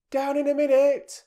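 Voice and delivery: high, loud call from upstairs; mother calling down the stairs. Acted. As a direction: call it down from above.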